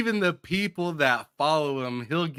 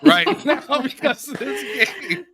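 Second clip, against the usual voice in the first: laughing; 13 semitones higher, 4 dB louder than usual. Real, not acted. Laughing about being angry at game.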